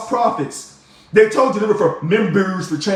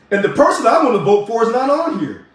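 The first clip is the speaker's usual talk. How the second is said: normal talking voice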